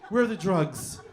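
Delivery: disguised, slightly effeminate superhero voice; lightly camp interrogation; effeminate Catman voice. Acted. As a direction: play a dainty vigilante cornering a dealer. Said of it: "Catman sounds like this".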